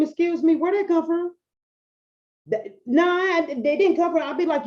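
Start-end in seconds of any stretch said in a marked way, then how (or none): 0.0-1.3 high, pointed mock offense at a client's phrase; mock indignant. Coach to client, pretending to be offended. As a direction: act scandalized at the wording, pitched up. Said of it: "if I was working with a client and I was like".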